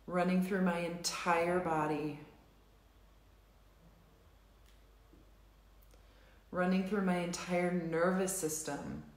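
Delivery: slow, measured setup phrases with long pauses; tapping script read. Acted. Tapping leader reads the setup statements to the group, slow and measured. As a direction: guide the group through each tapping statement.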